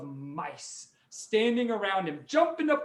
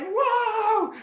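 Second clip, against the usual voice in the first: high, loud amazed exclamation; high amazed whoa; 6 dB louder than usual. Acted. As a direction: gasp in delight. Amazed at feast.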